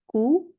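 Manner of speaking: cu said high and punched; stressed syllable. Shown as a demonstration. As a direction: hit the syllable cu. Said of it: "we will emphasize that syllable".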